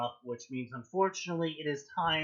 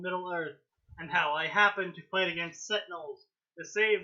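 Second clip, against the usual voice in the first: dramatic read that swells louder and higher at the end; fan fiction reading; 5 semitones higher, 5 dB louder than usual. Acted. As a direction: read the chapter like an epic, building to the last line. Reading fan fiction chapter aloud.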